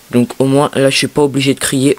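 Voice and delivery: normal talking voice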